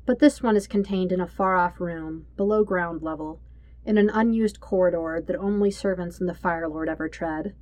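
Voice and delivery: plain reading voice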